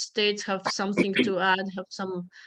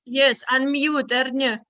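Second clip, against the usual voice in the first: raised voice, calling her by name to unmute; 5 semitones higher, 6 dB louder than usual. Real, not acted. Host calls out to a guest to unmute, louder. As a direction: call across the meeting so she hears.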